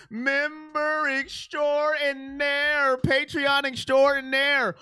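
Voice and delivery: very slow, lightly put-on announcer voice on "Member extraordinaire"; hammed-up announcer. Shown as a demonstration. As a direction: draw out "extraordinaire" like a ring announcer.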